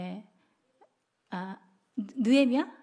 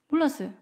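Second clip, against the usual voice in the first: "몰랐어요." said as a settled answer with a falling tune; statement tune; 2 semitones higher, faster than usual. Real, not acted. Teacher answering her own question, a falling statement. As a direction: close the question with a firm answer.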